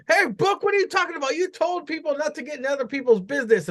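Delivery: high-pitched mocking impression of a complainer; high, indignant challenge to a friend; Vic. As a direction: pitch it way up, quickening toward the end. Acts out Vic confronting Book.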